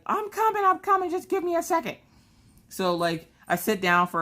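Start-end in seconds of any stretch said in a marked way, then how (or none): 0.0-2.0 squeaky breathless plea. Retold or imitated. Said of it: "I'm like".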